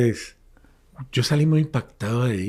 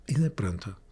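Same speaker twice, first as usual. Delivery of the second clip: suspense whisper; 4 semitones lower, 6 dB quieter than usual. A narrator's reading. Suspense in the story.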